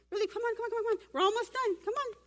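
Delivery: high, eager hurry-up calls; excited urging, come on come on. Real, not acted. Hurrying volunteers on stage.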